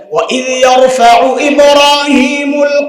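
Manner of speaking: slow, chanted Arabic recitation; Quran recitation. Acted. Preacher reciting the verse to the gathering, slow chant. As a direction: draw out each Arabic word in a chant.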